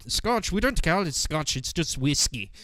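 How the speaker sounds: imitating a Scottish distillery worker, lightly accented; attempted Scottish accent. Distillery staff correcting tourists about scotch, attempted Scottish accent. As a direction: have a rough go at a Scottish accent. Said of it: "I can't do a Scottish accent".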